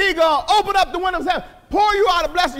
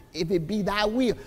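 mock timid prayer; 7 semitones lower, 5 dB quieter than usual.

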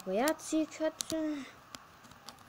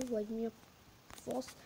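normal talking voice

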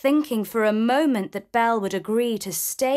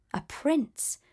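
ordinary delivery

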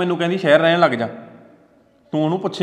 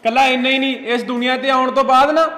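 building from raised voice to angry shouting; heated defence; 6 semitones higher, 4 dB louder than usual. Acted. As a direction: voice climbing higher and louder in anger. Husband defends mother in quarrel.

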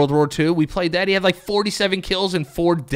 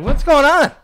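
high, loud call-out; mock greeting holler; 12 semitones higher, 8 dB louder than usual. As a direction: holler a hello as if across the street. Mimics hailing friend across the street.

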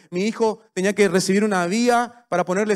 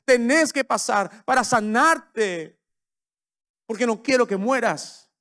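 higher and louder, slowing to press it home; God the father insisting with love; preaching at full force; 7 semitones higher, 4 dB louder than usual. Acted. God telling his child he must endure the process, preached loud.

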